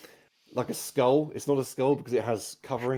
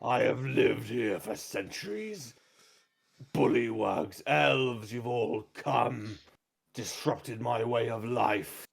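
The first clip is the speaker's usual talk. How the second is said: slow, rumbling dragon threat; menacing dragon; slower than usual. Acted. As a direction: growl it out like an ancient dragon. Dragon voice, echoing earlier hag.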